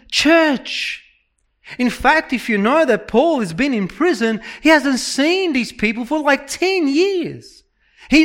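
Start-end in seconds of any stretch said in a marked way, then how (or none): none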